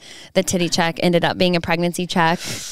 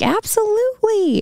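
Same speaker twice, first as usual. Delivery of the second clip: high mock old-lady voice, Southern; old lady's reply; 14 semitones higher than usual. Vet imitating the dog owner for her co-host, high mock voice. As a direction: squeak it out as the eager owner.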